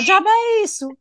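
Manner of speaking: put-on high, startled girl's retort, pitch shooting up; mock indignant girl. Girl on the beach snapping back at the boy, high and indignant. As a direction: play the girl who can't believe what she's seeing.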